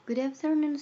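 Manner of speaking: high, loud chorus-like greeting; pupils' loud greeting. Acted. Pupils greet their teacher, high and loud.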